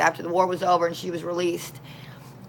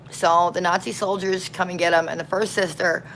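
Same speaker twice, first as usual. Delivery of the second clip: usual speaking voice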